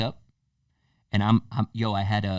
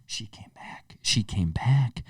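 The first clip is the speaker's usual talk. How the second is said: whispering; 6 dB quieter, slower than usual. Retold or imitated. Secret reveal in the story.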